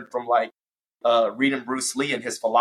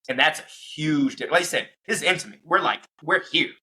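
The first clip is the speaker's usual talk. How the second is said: ordinary delivery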